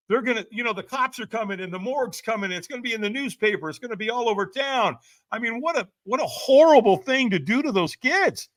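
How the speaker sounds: pleading with himself to stop; alarmed inner voice. Retold or imitated. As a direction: stop yourself in panic. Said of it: "I went".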